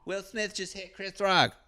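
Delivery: high mock wife voice saying "Chris Rock"; high put-on wife voice, "Chris" said clearly; wife's corrected take. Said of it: "she says it right".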